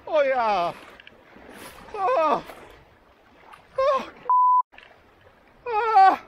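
shocked yelps from the freezing water; in ice cold water. Real, not acted. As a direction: gasp and shout as the cold hits. Cold-water plunge, gasping shouts. (okrzyki szoku w lodowatej wodzie)

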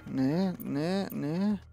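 ordinary talk

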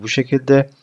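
ordinary delivery

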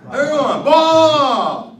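shouted cue for the class to repeat; loud call. Shown as a demonstration. Teacher rallying the class to repeat, loud shout. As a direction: call it out big to the whole room. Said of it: "say it loud".

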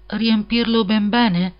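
slow, high, wistful echo of the demand; a bit melancholy. A narrator's reading. Hans echoes the miller's demand, slow and melancholy. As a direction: repeat it slowly, sad that he must give it all. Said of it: "in tono un po' malinconico".

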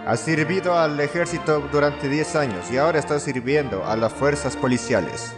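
ordinary talk

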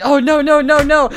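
loud, high mock panic; retold freak-out. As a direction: pile up panicked noes, imitating a friend. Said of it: "he's, like, freaking the fuck out".

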